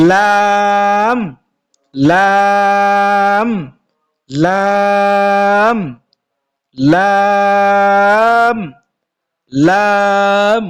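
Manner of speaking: লাম held long, tongue tip to palate; drawn-out Arabic letter lam. Shown as a demonstration. Teacher drills students on the letter, long drawn-out লাম. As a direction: stretch লাম slowly, tongue on the roof of the mouth. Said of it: "আপনার জিহ্বা আগা গিয়া উপরে".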